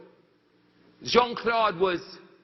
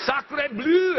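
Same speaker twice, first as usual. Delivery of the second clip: hammed-up French "Sacre bleu", higher and louder; French exclamation; 5 semitones higher, 5 dB louder than usual. Woodcutters stunned by the news, mock-French outburst. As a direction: relish the French oath. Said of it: "I like to say it".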